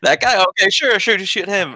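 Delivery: careless trigger-happy gamer. Retold or imitated. Carefree gamer mocking own play.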